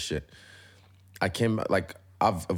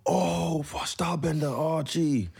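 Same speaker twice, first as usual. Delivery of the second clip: mimicking excited fan; 11 semitones higher, much slower than usual. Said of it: "and then this guy".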